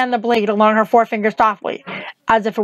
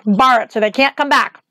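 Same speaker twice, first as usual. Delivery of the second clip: ordinary delivery, nothing marked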